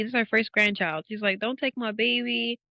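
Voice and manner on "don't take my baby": imitating crying mom. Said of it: "My mom's crying".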